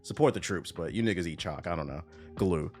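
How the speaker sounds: ordinary delivery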